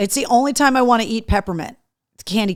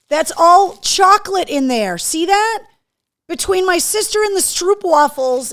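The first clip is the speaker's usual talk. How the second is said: excited gushing; 9 semitones higher, 7 dB louder than usual. Real, not acted. Gushing over gifts.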